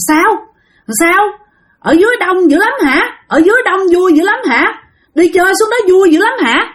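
put-on high, sing-song child talking to nobody; hospital boy asks an invisible friend, high and eerie. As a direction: voice the boy murmuring to someone unseen. Sick child talks to empty window.

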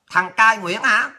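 normal talking voice

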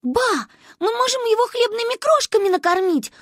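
put-on high, excited little girl; high-pitched childish eagerness; child's sudden inspiration. A narrator's reading. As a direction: blurt a brainwave to grandma. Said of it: "вдруг осенила Маньку".

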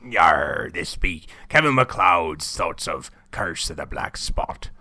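low, drawn-out pirate growl; slow, hammed-up pirate voice, opening on a long "Yar"; pirate impression. Shown as a demonstration. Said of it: "do you wanna do a pirate impression".

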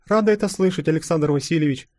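high, lightly voiced girl; young princess. A narrator's reading. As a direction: greet him with relief. Young tsarina replies. (Анастасия радостно отвечает Колчаку)

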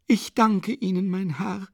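high, slow condescending thanks; von oben herab. A narrator's reading. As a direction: look down on him as you thank him. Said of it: "begann sie von oben herab". (hochnäsig, von oben herab)

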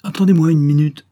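loud, high-pitched shout; calling out. A narrator's reading. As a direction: call to someone waiting outside. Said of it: "cria à Ambroise".

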